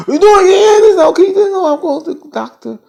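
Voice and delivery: very high, loud wailing falsetto; mock hysterical wail. Clarissa saying she's going to the doctor, mock wailing. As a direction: wail it hysterically, fading at the end.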